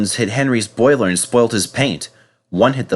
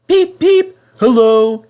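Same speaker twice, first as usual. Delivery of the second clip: much higher, loud cheery greeting; cheerful whistle; 13 semitones higher, 7 dB louder, slower than usual. Acted. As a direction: whistle hello to the boys. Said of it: "he whistled cheerfully".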